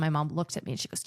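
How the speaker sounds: usual speaking voice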